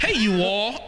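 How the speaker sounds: an overdone "you all" in place of y'all; saying you all. Shown as a demonstration. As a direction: call out "Hey, you all" like a stiff outsider. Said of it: "that's too much".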